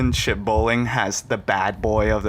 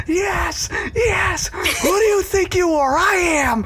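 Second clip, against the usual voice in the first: imitating Pete Weber's rant. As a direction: ham up a triumphant athlete's mad monologue. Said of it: "And he's just like".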